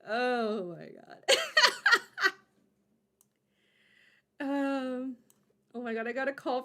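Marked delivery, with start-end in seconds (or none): 1.3-2.4 high, loud excited laugh; real giddy laugh. Real, not acted. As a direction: burst out laughing with nerves. Giddy after the call.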